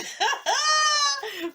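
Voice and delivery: high, loud laugh; high-pitched giggle burst; squealing laugh. Real, not acted.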